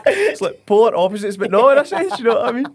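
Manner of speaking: high, loud words through laughter; laughing. Real, not acted. Laughing as he answers his friend's height joke. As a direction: still cracking up as he talks.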